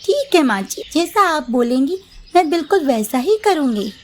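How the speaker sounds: put-on high young daughter-in-law promising obedience. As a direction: sound eager to please mother-in-law. Narrator voices daughter-in-law Mamta.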